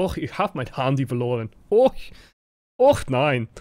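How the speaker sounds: camp mock-German lament; increasingly comical German accent. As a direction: fret theatrically in a cartoon German accent. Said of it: "increasingly comical".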